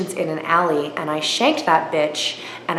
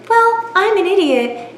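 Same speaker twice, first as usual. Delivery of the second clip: mock self-deprecating; 13 semitones higher than usual. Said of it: "And I'm like".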